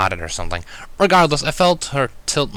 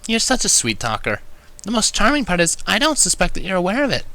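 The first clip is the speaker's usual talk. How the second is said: mumbled tease; 10 semitones higher than usual. A narrator's reading. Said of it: "Twilight mumbled into my ear".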